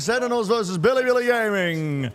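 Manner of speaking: high, loud, stretched-out hype call; announcer hype. Acted. Hyping the match like an announcer.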